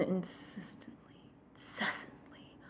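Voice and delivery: whisper. Real, not acted. Exasperated aside.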